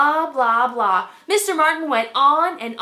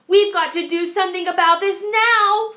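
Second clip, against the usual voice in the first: higher, louder urgent warning; 7 semitones higher, 6 dB louder than usual. A narrator's reading. Jessica warning her parents, urgent and raised. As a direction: press them to act right now. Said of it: "warned Jessica".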